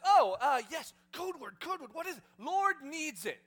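loud, high, panicked fumbling; flustered panic. Acted. Caught disciple scrambling for the code word, loud flustered panic. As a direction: blurt it out in a comic panic.

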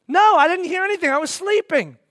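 loud, high protest; agitated denial. Acted. Believer answering the neighbor's question, loud and agitated. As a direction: protest it with rising alarm.

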